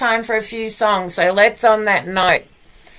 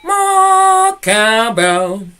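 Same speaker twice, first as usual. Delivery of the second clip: slow drawn call; 2 semitones higher, 3 dB louder, much slower than usual. Acted.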